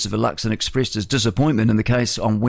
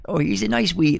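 light mock Scottish lilt on nice wee; a hint of Scottish, higher; lightly imitating a Southland local; Scottish accent; 8 semitones higher than usual. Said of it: "They talk like that down there".

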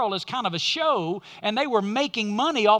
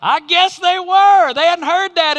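excited shout; 7 semitones higher, 10 dB louder than usual. Real, not acted. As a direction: shout it out with delight. Shouted punchline.

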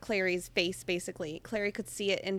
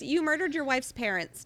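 ordinary talk

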